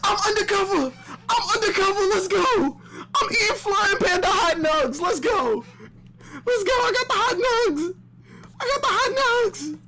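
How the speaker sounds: high, laughing shouts of joy; ecstatic yelling. Real, not acted. Fan celebrating his cameo, laughing and yelling high. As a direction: cheer like you just won.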